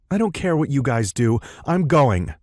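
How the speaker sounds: defiant. A narrator's reading. Tall woman defies the group.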